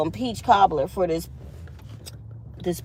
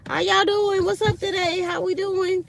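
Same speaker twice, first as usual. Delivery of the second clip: high, bouncy office greetings; cheerful morning person to coworkers; bubbly greetings; 12 semitones higher than usual. Retold or imitated. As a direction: greet everyone with too much joy. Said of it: "I just go around saying".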